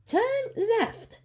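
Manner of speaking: very high, loud, puzzled squeak; innocent creature. A narrator's reading. Creature asking the way, innocent. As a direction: ask it all innocence. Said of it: "he said".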